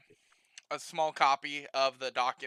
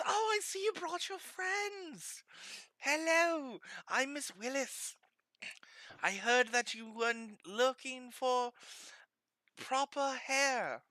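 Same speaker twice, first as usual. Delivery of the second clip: put-on high, slow, soft old lady voice; high sweet old lady; 7 semitones higher, 8 dB quieter, slower than usual. Barber welcoming the party, high slow old lady voice.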